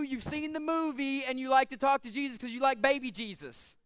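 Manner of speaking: ordinary delivery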